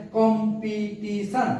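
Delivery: "Competition" slowly in four beats, কম, পি, টি, শন; short gaps between the parts of "competition"; split into syllables. Shown as a demonstration. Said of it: "চারটি syllable আছে".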